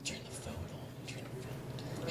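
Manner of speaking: barely audible, voiceless whisper; mouthed whisper. Acted. Son secretly instructing his dad mid-message, in a mouthed whisper. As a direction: mouth the words with almost no sound. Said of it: "I'm trying to mouth to him".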